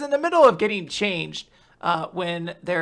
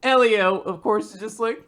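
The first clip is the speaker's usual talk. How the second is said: normal talking voice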